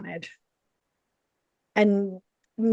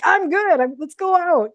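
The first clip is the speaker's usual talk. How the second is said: high, bubbly and louder; excited; 8 semitones higher, 3 dB louder than usual. Retold or imitated. To her husband after speaking on stage, high and giddy. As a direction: brush off the worry, buzzing to celebrate. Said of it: "I'm like".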